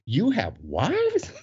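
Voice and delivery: slow, swooping incredulous question; mock astonishment. Men stunned the dancers are married, drawn-out astonishment. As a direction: stretch it out in utter disbelief.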